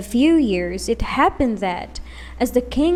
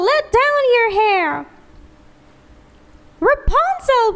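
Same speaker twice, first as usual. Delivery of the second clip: high, loud sing-song witch call; 13 semitones higher, 4 dB louder than usual. A narrator's reading. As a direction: call up the tower in a witchy singsong. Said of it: "she called out".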